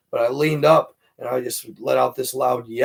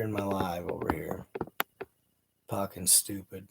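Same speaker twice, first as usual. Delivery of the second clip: soft unvoiced aside; whisper; 6 semitones lower, 13 dB quieter than usual. Real, not acted. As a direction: whisper it while busy off-mic. Aside while muting a hater.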